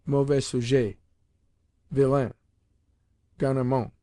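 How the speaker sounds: put-on "mauvais sujet" in a light French accent, plain tone; just name the French epithet, lightly; French phrase. Narrator quoting the tutor's names for the boys, light French. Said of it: "had names for us like".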